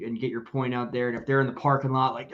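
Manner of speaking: ordinary delivery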